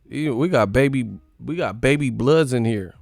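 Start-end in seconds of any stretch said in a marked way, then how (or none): none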